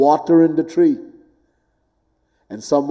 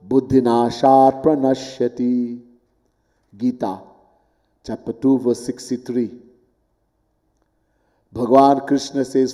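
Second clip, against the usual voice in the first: ordinary delivery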